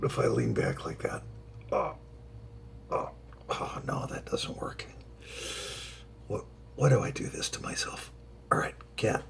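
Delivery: breathy struggling mutter; strained whisper. Real, not acted. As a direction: whisper while twisting awkwardly. Struggling with cat on shoulders.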